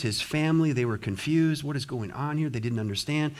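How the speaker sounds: ordinary talk, nothing marked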